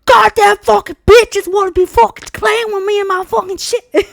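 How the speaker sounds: high, loud, furious rant; ranting to sisters. Retold or imitated. Ranting to her sisters on the phone, high and furious. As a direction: vent angrily down the phone. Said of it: "I call my sisters".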